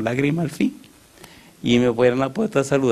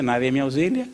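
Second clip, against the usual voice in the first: ordinary talk, nothing marked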